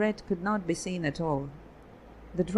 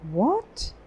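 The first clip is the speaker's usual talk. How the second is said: high, rising shocked question; a startled gasp of a question; shocked what; 8 semitones higher, 3 dB louder than usual. A narrator's reading. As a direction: caught completely off guard. Feluda shocked at revelation.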